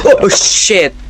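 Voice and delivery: sudden high yelp of fright; jolted by a scare and blurts it out; startled cry. Real, not acted. Gamer startled by a jump scare, high frightened yelp.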